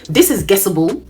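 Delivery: ordinary delivery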